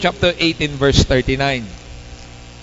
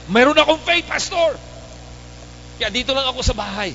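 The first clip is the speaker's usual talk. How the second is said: mock lazy believer; 9 semitones higher than usual.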